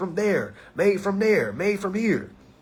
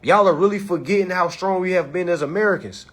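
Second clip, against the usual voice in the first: normal talking voice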